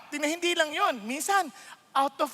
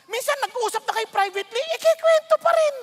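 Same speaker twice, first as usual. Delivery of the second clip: much higher and louder outburst; can't believe it; exasperated; 12 semitones higher, 6 dB louder than usual. Real, not acted. Pastor exasperated at repeat gossip, loud.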